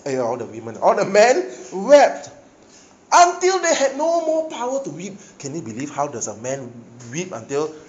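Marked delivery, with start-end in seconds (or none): 0.8-5.1 men weeping loudly. Shown as a demonstration. Preacher showing how David's men wept, loud and high. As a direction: cry it out until no power left. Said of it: "not the women's voice".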